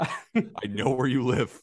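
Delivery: slow, creepy stalker voice; drawn-out menacing stalker line; heavy breathing phone whisper. Pretend. Creepy mock threat.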